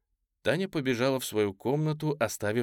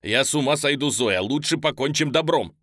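громкое отчаянное требование; кричать в запертую дверь, угрожая; крик; 4 semitones higher, 7 dB louder than usual. A narrator's reading. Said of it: "крикнул он". (страстный громкий напор)

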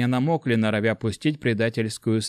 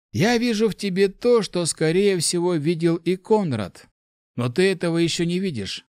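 higher-pitched, earnest encouragement; warm velvet mage; 7 semitones higher than usual. A narrator's reading. Mage consoles the weeping young man, warm and insistent. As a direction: urge him firmly but kindly, higher voice. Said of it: "Это уже был не тёплый бархат".

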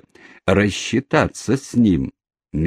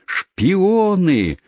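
crowd yell; 11 semitones higher than usual. Acted. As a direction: hurl the accusation as a mob. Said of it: "орала толпа".

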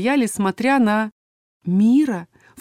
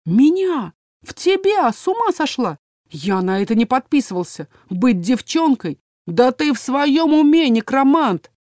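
usual speaking voice

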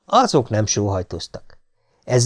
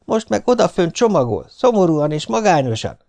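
higher, lightly mournful reproach; voice of conscience; 6 semitones higher than usual. A narrator's reading. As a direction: prick his guilt, pitched up. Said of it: "a lelkiismeret hangja".

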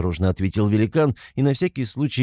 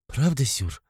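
sniffling; 5 semitones higher, slower than usual. A narrator's reading. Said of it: "всхлипнула Руди".

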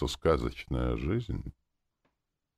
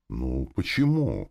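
slow, drawn-out protesting why; sincere indignation; 3 dB louder, slower than usual. A narrator's reading. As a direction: protest it, stretching the words. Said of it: "искренне я возмутился".

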